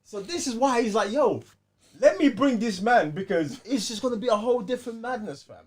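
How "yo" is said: ordinary talk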